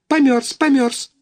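lightly voiced goslings; goslings piping. A narrator's reading. Goslings answer each other.

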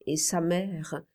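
plain reading voice

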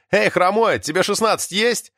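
high-pitched mild shout; calling out. A narrator's reading. Alyokha hails Artyom across the yard, calling out. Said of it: "замахал рукой и крикнул".

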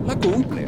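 "la cumple" thrown up high and loud, proud; emphatic boast. Acted. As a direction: punch "la cumple" like a promise sealed. Boasting he keeps his word.

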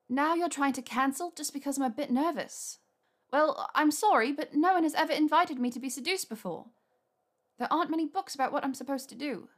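flustered and defensive; Hermione voice. A narrator's reading. Hermione voiced, nervous ranting.

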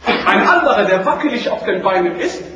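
normal talking voice

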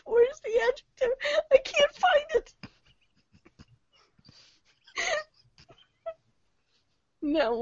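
laughing and squeaking through words. Real, not acted. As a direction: read it while cracking up. Reading collapses into laughter.

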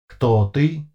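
metallic voice. A narrator's reading. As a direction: ask it with no emotion. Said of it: "Снова прозвучал странный голос".

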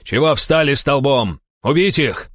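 high, loud angry command; barked order. A narrator's reading. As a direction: bark at idle men to attack. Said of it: "рявкнул Денис". (гневный командный окрик)

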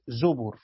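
زبر said "zubur"; damma on the ب. Shown as a demonstration. Teacher specifies the word for students, damma on the ب in زبر. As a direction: put a u after the ب in زبر. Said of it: "بضم الباء".

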